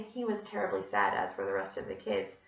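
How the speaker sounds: ordinary delivery, nothing marked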